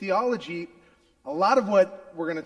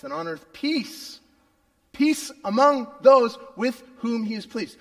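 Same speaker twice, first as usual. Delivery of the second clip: loud, building scripture reading; 2 semitones higher, 7 dB louder than usual. Acted. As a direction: read it out like a proclamation. Reads Luke 2 aloud.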